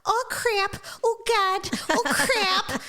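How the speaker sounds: high-pitched, hammed-up Minnesotan; Minnesotan accent. Host mimicking Family Guy scene on request, Minnesotan accent. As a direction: cry out in a broad mock Minnesotan accent. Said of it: "Do the Minnesotan accent".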